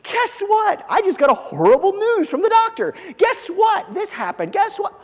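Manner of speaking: high, loud mock-excited chirp; mock cheerful announcing. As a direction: chirp it like bubbly good news. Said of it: "and go".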